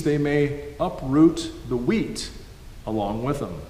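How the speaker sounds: ordinary talk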